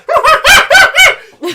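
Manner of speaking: very high, loud screech of a laugh; high-pitched creature cackle. Acted. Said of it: "and with a".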